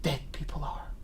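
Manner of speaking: hushed despairing whisper. Acted. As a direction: breathe it to yourself. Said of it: "I say to myself".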